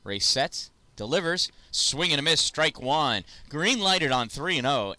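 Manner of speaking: live play-by-play. Acted. Calling the at-bat for viewers, excited play-by-play. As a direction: call each pitch like a ballpark announcer. Calls live pitches on the field.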